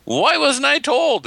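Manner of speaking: very high-pitched, over-the-top retold outrage; hammed-up high wail of disbelief; mock freak-out. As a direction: freak out in a squeaky voice, slowing on the last question. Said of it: "I'm freaking out on them going".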